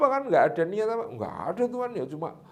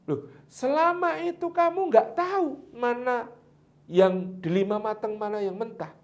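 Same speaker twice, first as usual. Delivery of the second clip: astonished master; 8 semitones higher than usual. Acted. Master questions the gardener.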